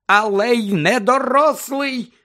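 high, loud, slowed angry retort; flushed, worked-up shout; heated outburst. A narrator's reading. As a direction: fire back hotly, stretching the words. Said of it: "аж розпашілася місіс Візлі".

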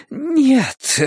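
high, distressed moan. A narrator's reading. Fortune-teller hearing the woman's name, moaning high. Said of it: "простонала она".

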